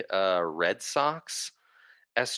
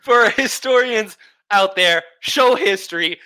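excited announcement; 7 semitones higher, 7 dB louder than usual. Real, not acted. Mock announcement of show history.

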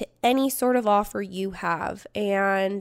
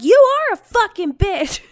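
loud, high retold shout; yelling back; 9 semitones higher, 8 dB louder than usual. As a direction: act out hurling it in her face.

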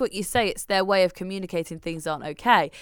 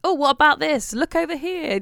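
high, louder bright distracting voice; mock excitement; 8 semitones higher, 5 dB louder than usual. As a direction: lure the toddler's attention, pitched up. Distracting the child.

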